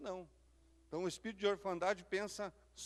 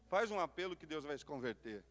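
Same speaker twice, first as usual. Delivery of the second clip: usual speaking voice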